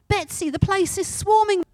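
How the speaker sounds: high, loud, panicked call to her sister; alarmed cry. Acted. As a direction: sound the alarm. Corrie panics at fleas.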